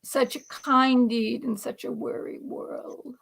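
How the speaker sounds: slow hushed whisper. Acted. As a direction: breathe the line softly. Quoting Willy Wonka line.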